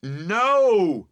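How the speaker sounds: long, loud high shout. A narrator's reading. Boatman answering Emily, shouting. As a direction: bellow it out, drawn long. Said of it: "you shout".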